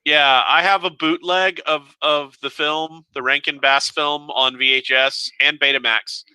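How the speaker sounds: ordinary talk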